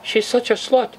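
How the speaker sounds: usual speaking voice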